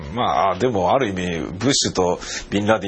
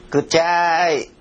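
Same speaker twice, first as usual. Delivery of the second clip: high, slow whiny "くちゃーい"; mock disgust; 7 semitones higher, slower than usual. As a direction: whine it like smelling something awful. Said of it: "と思いました". (臭さに顔をしかめる声)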